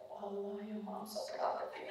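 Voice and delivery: whispered mother's pride; mother whispering her pride down the phone. Retold or imitated. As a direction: hush it like a secret blessing.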